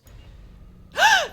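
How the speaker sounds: sharp high gasp of horror; shocked gasp. Real, not acted. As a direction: gasp in sudden panic. Game shows new game.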